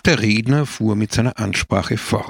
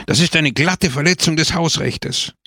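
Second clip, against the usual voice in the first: higher-pitched protest; indignant; 6 semitones higher than usual. A narrator's reading. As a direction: voice outrage at the intrusion. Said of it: "seiner Empörung freien Lauf zu lassen".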